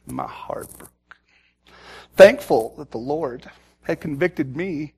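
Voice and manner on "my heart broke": barely voiced, slow and low; quiet grief, almost no voice; heartbroken. Real, not acted. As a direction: let it drop to a broken whisper. Grief at his story.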